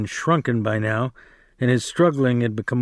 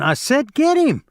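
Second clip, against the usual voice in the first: very high, louder insistent yell; 13 semitones higher, 3 dB louder than usual. Acted. George yelling at Lennie again, high insistent yell. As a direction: demand it at the top of your voice. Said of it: "George yelled again".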